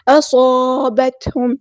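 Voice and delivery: اصابتهم with full heavy sad; اصابتهم, thick heavy sad and long alif. Shown as a demonstration. Teacher shows heavy pronunciation to students. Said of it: "موٹا ہے".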